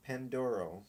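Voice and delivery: plain reading voice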